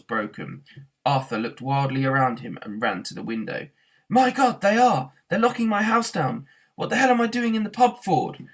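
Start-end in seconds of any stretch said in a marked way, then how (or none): 4.1-8.5 panic. Acted. Arthur sees house demolished.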